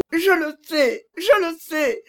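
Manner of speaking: crying harder. A narrator's reading. Agnès weeps harder, admitting her fault to Stephenson. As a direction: break down weeping, voice high. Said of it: "reprit Agnès en pleurant encore plus fort".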